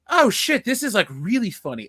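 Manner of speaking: high, loud "oh" easing down; retold burst of amused realization; amused surprise. Retells own delighted reaction.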